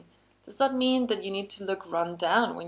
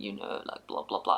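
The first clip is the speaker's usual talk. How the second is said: deep, soft, whispery drone of a bored person; low-energy mumble; 11 semitones lower, 6 dB quieter than usual. Shown as a demonstration. Showing viewers the dull energy to avoid, in a deep mumbling drone. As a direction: let the energy drain out, barely voicing it. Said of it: "this is super silly and exaggeration".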